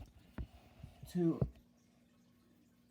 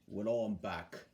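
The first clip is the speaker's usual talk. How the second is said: low, quieter bus character; Bulgy; 9 semitones lower, 5 dB quieter than usual. Acted. Bulgy answering Duck's greeting, low and subdued. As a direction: drop down into the bus's voice. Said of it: "said Bulgy".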